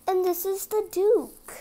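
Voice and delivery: usual speaking voice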